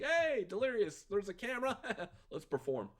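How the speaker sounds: high, loud "Hey, delirious"; a giddy overtired voice egging itself on; mock excited. Host joking about being overtired, in a mock excited voice.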